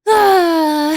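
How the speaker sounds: high, loud, falling sigh. Real, not acted. Streamer before weighing up Seattle's weather, loud sigh. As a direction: let out a big voiced sigh.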